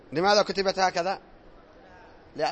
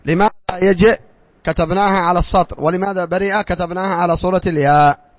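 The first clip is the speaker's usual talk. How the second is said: loud question; 8 dB louder than usual. Real, not acted. Teacher quizzing class loudly.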